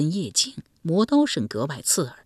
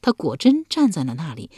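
ordinary delivery

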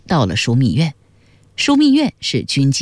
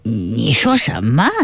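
low stammer sliding up into a question; falter, then stretch the question oddly upward; startled, low, uneasy stammer; odd, strained tone; 6 semitones lower than usual. A narrator's reading. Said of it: "发出怪异的腔调".

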